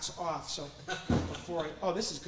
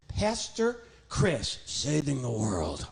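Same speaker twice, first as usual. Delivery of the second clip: slow drawn-out; 3 semitones lower, much slower than usual. Acted. Puppet drawls slowly.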